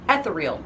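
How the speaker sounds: Ethereal as ETH-er-eel; misreading. Shown as a demonstration. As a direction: stress ETH at the start of Ethereal. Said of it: "That's how I see it".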